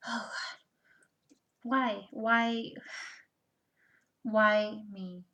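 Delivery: loud mock lament, voice lifted on each "why"; imitated yelling upward. Reader voicing someone questioning God, mock yell. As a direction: act out an exasperated plea to the heavens. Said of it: "you ever yell up and go".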